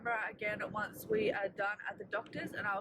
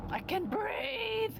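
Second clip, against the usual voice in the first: relieved delight; 14 semitones higher, much slower than usual. Real, not acted. Relief after splints removed.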